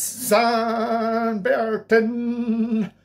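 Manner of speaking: high, slow, drawn-out mock French singer. Jokey vlogger hams up a French singer for viewers. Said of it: "A wee bit of [name] there for you".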